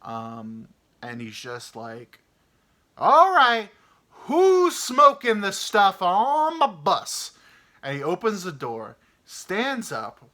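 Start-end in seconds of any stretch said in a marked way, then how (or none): 3.0-7.3 loud, high, slow angry demand; furious driver hunting the smoker; driver going off. Retold or imitated. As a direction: roar it down the aisle. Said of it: "this dude's going off".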